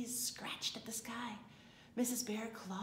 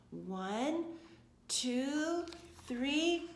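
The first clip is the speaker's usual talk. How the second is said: spaced-out rising count; suspenseful countdown; 5 semitones higher, 5 dB louder, much slower than usual. A narrator's reading. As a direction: count up, each number higher and louder. Counting up to the surprise.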